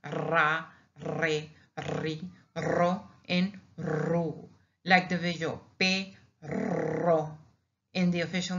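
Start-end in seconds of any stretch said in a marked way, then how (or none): 0.0-4.5 Ra, re, ri, ro, ru each opened with a trill; rolled R syllables. Shown as a demonstration. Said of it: "roll one that goes round". 5.8-7.4 perro with a long trill in the middle; rolled double R. Shown as a demonstration. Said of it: "Now we have the double R".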